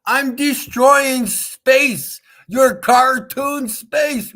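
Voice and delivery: slow, high, loud taunt; loud gloating. Real, not acted. Streamer mocking believers in a globe, loud gloating. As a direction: stretch each word out loud and high.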